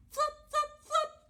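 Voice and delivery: high, loud, bouncy flops; three punchy high sound words; flopping sound effect. A narrator's reading. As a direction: pop each word out like a hop. Story sound of flopping in snow.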